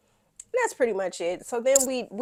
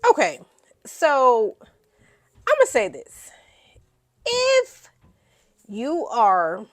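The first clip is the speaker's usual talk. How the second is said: louder, setting up a hot take; loud announcement; 3 semitones higher, 8 dB louder, slower than usual. Real, not acted. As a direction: declare it to the viewers. Announcing a blunt opinion.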